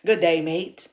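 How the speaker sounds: loud Australian greeting through a big smile; Aussie accent attempt. Shown as a demonstration. Practising for Australian viewers, grinning Aussie accent. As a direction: grin wide and push out an Aussie hello. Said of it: "What a big smile".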